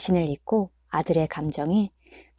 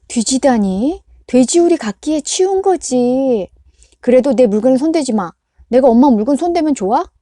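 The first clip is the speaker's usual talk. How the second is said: heated quarrel; 6 semitones higher, 7 dB louder than usual. A narrator's reading. Mother and son quarrel.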